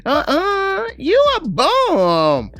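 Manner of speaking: hammed-up shrieking grandma scolding grandson; scornful grandma voice. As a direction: squeal it way up high and loud, slow on bum.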